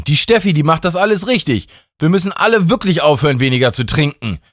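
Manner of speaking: a tipsy rallying cry; god Justin. A narrator's reading. God Justin urges the friends to drink, high and fast. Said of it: "rief er".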